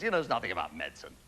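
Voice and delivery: normal talking voice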